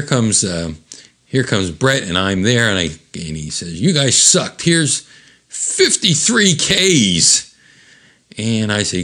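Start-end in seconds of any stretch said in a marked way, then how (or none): 3.8-7.5 dismissive club owner. Retold or imitated. Club owner pays the band.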